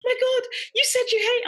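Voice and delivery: high, loud imitating her own crying; mock tearful wail. Mum tells the host she rang home in tears, mock tearful wail. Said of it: "I'm in tears going".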